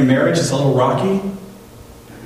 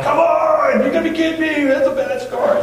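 parents yelling. Retold or imitated. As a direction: shout down the judges like angry parents. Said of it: "Parents are yelling and yelling".

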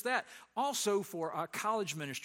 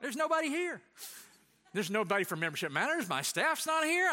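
high, loud, incredulous alarm; retold alarm; 7 semitones higher, 4 dB louder than usual. Pastor finds the empty church, high loud alarm. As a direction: panic as if everyone left. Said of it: "And I go".

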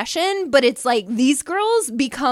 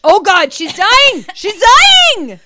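loud, higher mock panic; mock alarm; 6 semitones higher, 8 dB louder, slower than usual. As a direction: cry out in hammed-up alarm. Mock alarm as co-host coughs.

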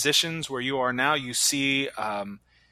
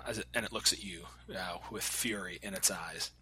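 whispering; 5 semitones lower, 10 dB quieter than usual. A narrator's reading. Ominous narration of gnoll's fury.

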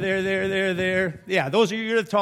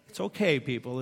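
ordinary talk, nothing marked

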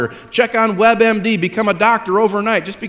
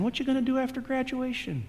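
nosy relatives; 6 semitones higher, 12 dB quieter than usual. Retold or imitated. Everybody keeps asking student.

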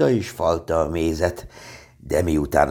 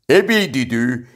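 loud, raised tiger call; shouting; 5 semitones higher, 5 dB louder than usual. A narrator's reading. Tigger bursting into Piglet's house, shouting. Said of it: "kiabált a Tigris".

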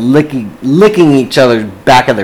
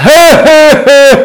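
mock booming laugh, pitched way up and louder; bellowing laugh; 6 dB louder than usual. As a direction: ham up the clerk's big showoff laugh. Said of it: "lets out this bellowous laugh".

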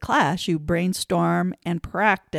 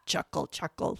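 quiet chuckle; 3 semitones lower, 8 dB quieter than usual. Shown as a demonstration. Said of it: "how about using synonyms".

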